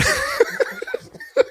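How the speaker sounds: high, shrieking laugh; burst of laughter. Real, not acted. Host cackling at Tofia's story, shrieking laugh. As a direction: cackle at how absurd it sounds.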